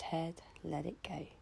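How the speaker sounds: whispered "head, let it go" with no voice; whispered release. Shown as a demonstration. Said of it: "Belly, chest".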